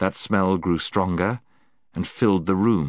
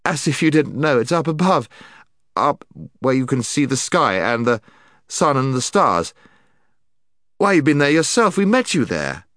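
insistent; 7 semitones higher, 5 dB louder than usual. Acted. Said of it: "fighting hard against the enchantment".

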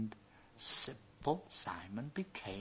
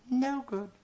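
high dismissive princess; cartoon princess; 13 semitones higher than usual. A narrator's reading. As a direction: wave him off, bored and high.

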